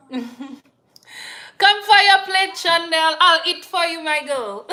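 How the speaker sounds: loud, high, slow call. Real, not acted. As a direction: call someone to the table. Calling daughter to eat.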